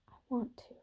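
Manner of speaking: soft unvoiced whisper, almost silent; tiny whisper. A narrator's reading. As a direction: breathe the words out in a whisper. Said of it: "in that tiny whispering voice".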